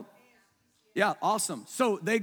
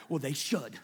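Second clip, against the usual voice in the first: a dismissive little aside, much lower and softer; lightly voiced smug retort; mock smug reply; 9 semitones lower, 6 dB quieter than usual. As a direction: toss it off as the dog lover. Imitates a dog lover's reply.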